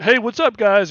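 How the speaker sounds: high, bright mock greeting; mock cheerful greeting. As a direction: chirp it like a cheesy photo caption. Mocking a remote draft photo.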